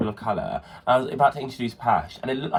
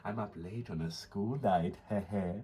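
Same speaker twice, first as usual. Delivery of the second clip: slow, softer mock-flirty voice; imitated creepy texter; 3 semitones lower, 11 dB quieter, slower than usual. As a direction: draw it out quietly, lightly mocking. Mimicking teacher's creepy flirty text.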